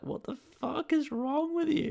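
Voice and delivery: very high-pitched mock outrage; incredulous outrage. Reacting to Davis praising Twice Upon a Time, very high mock outrage. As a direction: shoot the pitch way up in disbelief. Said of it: "I'm like".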